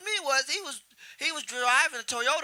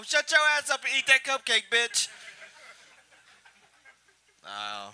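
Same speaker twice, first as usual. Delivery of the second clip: usual speaking voice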